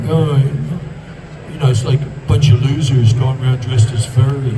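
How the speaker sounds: drawn-out dismissive "oh", mimicking a jaded actor; mock a sniffy actor, starting very slowly; imitating a scornful colleague, hesitant drawl at first. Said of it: "when actors are like".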